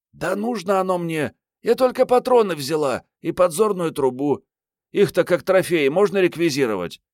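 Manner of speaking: высокий девичий голос, задиристо оправдывается; бойкая героиня книги. A narrator's reading. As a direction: play a feisty young woman, pitched high. Озвучивает героиню Мариетту. (высоким голосом задорной девчонки, оправдываясь)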